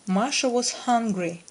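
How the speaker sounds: plain reading voice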